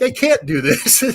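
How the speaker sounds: high, slow, whining despair; mock despair. Recalling his first try at the speech, mock despairing whine. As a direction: play at giving up in a thin whine. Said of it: "and I'm like".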